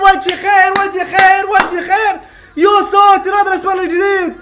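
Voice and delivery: high, loud repeated complaint; exasperated shouting. Real, not acted. Fan joking his luck brought the goal, loud exasperated shouting. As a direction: keep yelling in disbelief.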